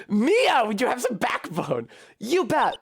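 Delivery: a hint of a condescending senior; scolding prosecutor.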